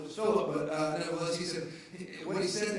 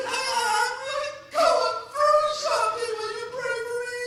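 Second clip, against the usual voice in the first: high, imploring plea for prayer; colorful church lady; 13 semitones higher, 5 dB louder than usual. Retold or imitated. Sister Brenda begging her pastor for prayer, high. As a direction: beg the pastor to pray, pitched up high. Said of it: "she's real colorful".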